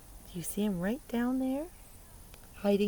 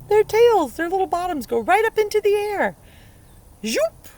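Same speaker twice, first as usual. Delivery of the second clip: high and loud, giggly; playful glee; 13 semitones higher, 10 dB louder than usual. Acted. Teacher telling kids about duck bottoms, playful. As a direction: make it funny and bouncy.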